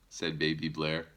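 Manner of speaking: usual speaking voice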